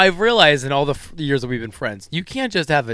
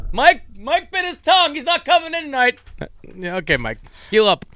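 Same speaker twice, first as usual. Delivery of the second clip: mock boss; 8 semitones higher than usual. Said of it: "mock boss on phone".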